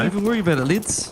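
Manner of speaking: a stiff, overeager Kiwi impression; uptight New Zealand impression. Co-caster trying a teammate's voice, tense New Zealand accent. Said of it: "Yeah, not quite like that".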